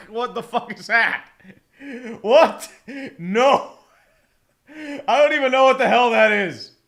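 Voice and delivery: loud, incredulous disbelief; baffled outburst. Real, not acted. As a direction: burst out, totally thrown by it. Baffled by the unreadable name.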